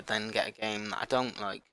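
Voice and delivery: ordinary delivery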